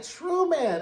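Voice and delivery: high drawn-out Truman; scolding Truman. Retold or imitated. Viewer scolding Truman for cruelty, high and scolding. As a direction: call him out high and long. Reacting to Truman reading Ann.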